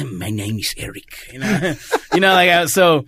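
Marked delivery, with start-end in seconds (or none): 0.0-1.3 put-on soft Spanish accent; cool Spanish accent, spoken softly; Antonio Banderas. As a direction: introduce yourself in a quiet, suave Spanish accent. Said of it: "have, like, a cool accent".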